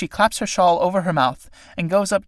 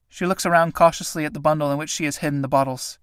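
ordinary delivery

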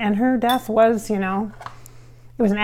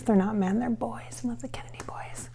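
fading into a soft whisper, much softer; Marilyn whispering; 10 dB quieter than usual. Retold or imitated. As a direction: confide it in a hush like Marilyn. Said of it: "That's how she says".

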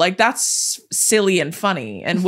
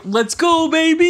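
high-pitched hammed-up cheer; mock fan cheer; 9 semitones higher than usual. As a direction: whoop it like a hyped-up sports fan. Repeats the sports fan impression.

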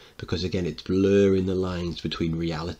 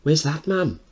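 put-on worried child; 9 semitones higher, slower than usual.

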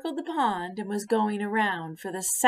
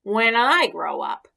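proudly; 3 semitones higher, 8 dB louder than usual. Acted. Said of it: "he said proudly".

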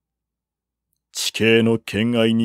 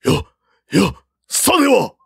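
high shocked exclamation; startled cry; 9 semitones higher than usual. A narrator's reading. General realizing the ambush, startled cry. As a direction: gasp it out in shock.

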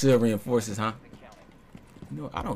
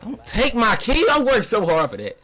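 protest; 12 semitones higher, 6 dB louder than usual. Real, not acted. Teammate stealing his kill.

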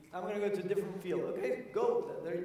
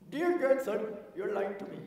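higher, louder stern rebuke; stern grandfather; 5 semitones higher, 5 dB louder than usual. Retold or imitated. Grandfather confronting grandson with his lie. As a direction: call out the lie firmly.

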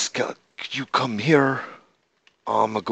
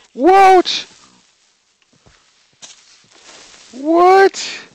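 much higher, much louder shriek; shocked yell; 13 dB louder than usual. Real, not acted. Creeper pops out behind the pig, shocked shriek. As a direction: shriek in disbelief.

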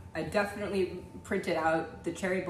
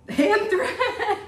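high, loud laughing shout; real laughter bursting through the word; cracking up; 11 semitones higher, 6 dB louder than usual. Real, not acted. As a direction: blurt it out as laughter takes over. Cracking up at band names.

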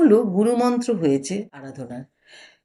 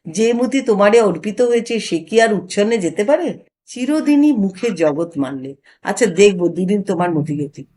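plain reading voice

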